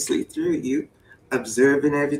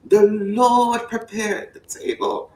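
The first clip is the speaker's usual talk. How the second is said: higher, slow sermon delivery; high slow preaching; 7 semitones higher, slower than usual. Pretend. Fired-up preacher pressing the scripture point. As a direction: stretch each word from the pulpit. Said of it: "I be getting fired up".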